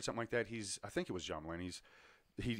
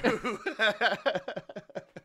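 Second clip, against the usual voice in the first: long high laugh; loud, high cackling laughter; 13 semitones higher, 8 dB louder than usual. Real, not acted. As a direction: burst out laughing at the punchline. Laughs at own punchline.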